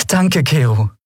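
put-on grateful young captain to her friend; Endriel's thanks. A narrator's reading. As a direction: beam as you thank him.

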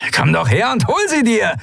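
high, taunting shout; defiant call. A narrator's reading. Lun to the commander who wants the weapons, defiant call. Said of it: "rief Lun".

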